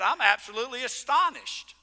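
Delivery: ordinary talk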